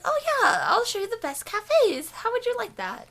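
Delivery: very high, chirpy offer; eager woman. Acted. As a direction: pitch it up as the keen local woman. Woman offering to show cafes.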